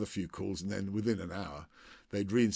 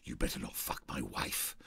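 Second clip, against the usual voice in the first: low, under-the-breath warning; a hint of a drunk jealous husband; menacing host; 7 semitones lower, 4 dB quieter than usual. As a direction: growl it low as a threat. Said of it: "I think I said before".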